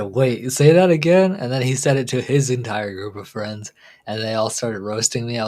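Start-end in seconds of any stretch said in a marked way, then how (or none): 0.0-1.3 louder and higher, slow disbelieving ask; friend's amused question. Retold or imitated. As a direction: imitate the friend pressing for a repeat.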